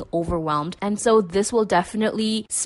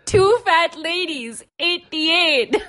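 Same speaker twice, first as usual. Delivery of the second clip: much higher, slow drawled bingo call; Matthew McConaughey; 9 semitones higher, 4 dB louder, slower than usual. Retold or imitated. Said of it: "McConaughey saying".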